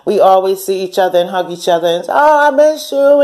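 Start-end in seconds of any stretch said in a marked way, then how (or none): 2.1-3.2 high, loud, slow gushing "Oh, I miss you"; sweet greeting. Retold or imitated. Old friends hugging, a high gushing sweet greeting. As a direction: gush over an old friend.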